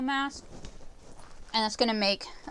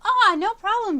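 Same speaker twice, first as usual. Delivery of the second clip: bright burst of high excitement; excited; 11 semitones higher, 11 dB louder than usual. Real, not acted. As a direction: call it out with delight. Reacting happily to chat.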